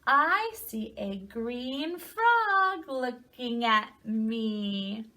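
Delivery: slow, lilting read-aloud with stretched words; storybook reading to children. A narrator's reading. Teacher reading a picture book to children, slow singsong storytelling. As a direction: read slowly, swooping up on key animal names.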